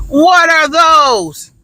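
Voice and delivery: slow, high taunting "What are those?"; mocking kid. Kid taunting classmate's shoes, mocking and slow. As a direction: drag it out like a schoolyard taunt. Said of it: "points at your shoes and goes".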